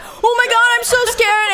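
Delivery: high, put-on frightened squeal; mock scared boy. Date using a comedy horror film to snuggle, hammed-up fright. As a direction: imitate badly faked fear. Imitates boy's fake fright.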